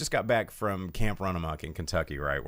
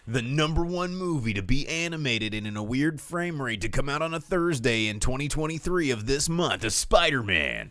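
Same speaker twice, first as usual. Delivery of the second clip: higher, louder hammed-up trailer voice; over-the-top movie trailer announcement; mock announcer; 6 semitones higher, 3 dB louder than usual.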